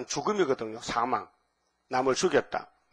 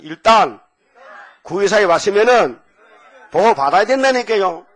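much louder, higher-pitched exhortation; emphatic shout; 7 semitones higher, 11 dB louder than usual. Real, not acted. Preacher telling congregation to repeat after him, shouting.

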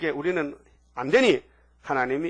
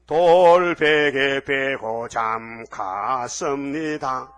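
slow preaching drawl; 7 dB louder, much slower than usual. Acted. Preacher stretching the line out loud and slow for the congregation.

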